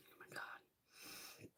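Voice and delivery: slow, breathy whispered gasp. Retold or imitated. Imitating her stunned reaction to the packaging, whispered. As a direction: hush it like stunned disbelief. Voicing herself opening the box.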